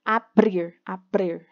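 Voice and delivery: "abrir" with a light tapped first R; soft R between sounds. Shown as a demonstration. Teacher showing pupils the weaker R sound, "abrir" with tapped R. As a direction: say "abrir" with a quick flick on the R after B. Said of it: "ele tem esse som de pr".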